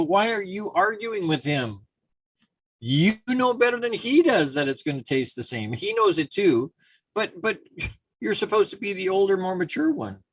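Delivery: high-pitched, emphatic parental reproach; exasperated scolding. Retold or imitated. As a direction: take the older kid to task, stretching the words. Father scolds the older sons.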